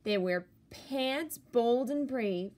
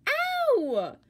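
a sudden high yelp of a howl; monster howl; 7 dB louder than usual. Acted. Narrator reading a picture book, a monster's high yelp. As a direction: shriek like a stubbed monster.

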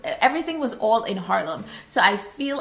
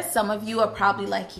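ordinary talk, nothing marked

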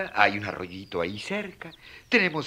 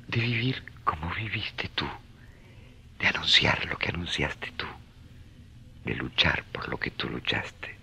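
hushed prayer; 7 semitones lower than usual. Acted. Francis prays alone at dawn.

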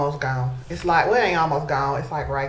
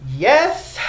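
higher, louder burst of yes; excited yes; 7 semitones higher, 5 dB louder than usual. Real, not acted. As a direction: cheer it like they guessed right. Viewers guess the perfume.

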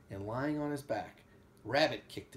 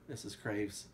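ordinary delivery, nothing marked

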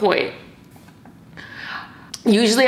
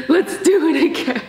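high laughing voice; 6 semitones higher than usual. Real, not acted. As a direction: laugh through the joke. Laughing joke about repeating.

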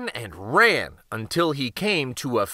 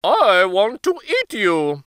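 cow voice; 5 semitones higher, 4 dB louder than usual. A narrator's reading. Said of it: "said the cow".

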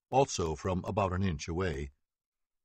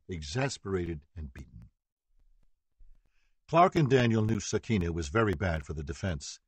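plain reading voice

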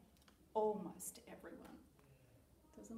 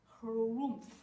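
loud walrus harrumph; big rumbly animal grumble; sniffing walrus; 9 dB louder than usual. A narrator's reading. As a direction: grunt it loudly like a sniffly walrus. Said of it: "sniffed Walrus".

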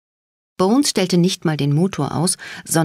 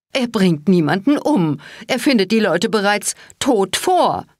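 shrill retort; 5 semitones higher than usual. A narrator's reading. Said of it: "keifte sie".

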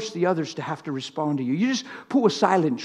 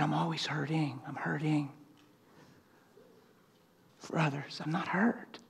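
much softer, hushed to a whisper; 3 semitones lower, 13 dB quieter than usual. Retold or imitated. The Lord whispering reassurance to his heart, hushed. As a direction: breathe it quietly like a secret. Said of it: "the Lord whispered to my heart".

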